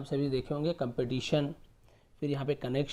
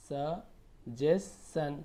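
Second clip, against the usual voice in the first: Suggestion stretched very slowly; syllable by syllable; much slower than usual. Shown as a demonstration. Teacher breaking suggestion into slow syllables for the class. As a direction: sound out suggestion piece by piece. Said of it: "तोड़ तोड़ के पढ़िए आप".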